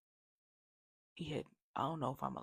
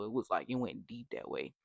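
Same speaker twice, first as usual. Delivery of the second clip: normal talking voice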